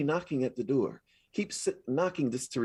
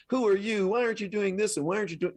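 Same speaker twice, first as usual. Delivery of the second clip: shaming inner critic; 8 semitones higher, faster than usual. Retold or imitated. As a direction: scold like a guilt-tripping critic. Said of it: "a voice that guilts you and shames you".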